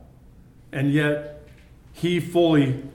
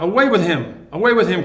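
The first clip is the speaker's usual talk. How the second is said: louder mock crowd cry; crowd's cry; 3 semitones higher, 4 dB louder, faster than usual. Preacher voices the crowd rejecting their king, louder. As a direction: call it out like the crowd. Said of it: "They cried out".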